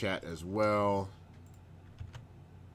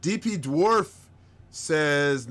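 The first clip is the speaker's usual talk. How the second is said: ordinary talk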